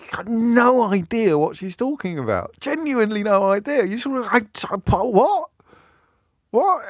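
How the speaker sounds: high-pitched, incredulous bewilderment; baffled. Acted. Dad cannot understand the headmaster's wife, baffled. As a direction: play it utterly lost, voice climbing. Acting out dad's total bafflement.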